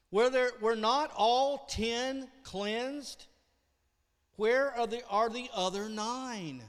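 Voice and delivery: Jesus asking loudly where the other nine lepers went; emphatic question. Acted. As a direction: demand an answer from the crowd. Preacher quotes Jesus emphatically.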